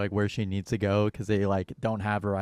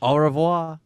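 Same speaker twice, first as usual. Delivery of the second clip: louder, higher mock French farewell; hammed-up French accent; 7 semitones higher, 7 dB louder, slower than usual. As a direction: wave goodbye like a cartoon Frenchman. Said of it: "very thick French accent".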